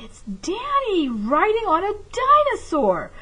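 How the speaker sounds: lightly voiced amazed kid; excited child. A narrator's reading. A child exclaims in story.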